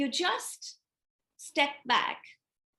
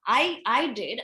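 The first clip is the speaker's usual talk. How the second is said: ordinary talk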